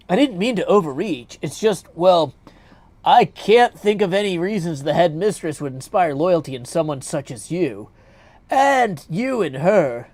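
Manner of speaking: goddess needling him with mock innocence; teasing goddess. Heroine teasing again.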